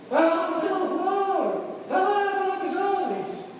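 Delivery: voz bem mais aguda e arrastada, debochando; imitação zombeteira. Retold or imitated. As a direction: imitar a esposa com falsete irônico. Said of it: "Aí eu ficava mesmo". (marido imitando a esposa debochada, agudo)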